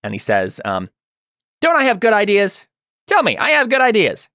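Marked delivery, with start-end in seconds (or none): 1.6-4.3 high-pitched, pleased-with-himself character voice; proud little boast, pitched way up; proud Git. Acted. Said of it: "seems proud of himself".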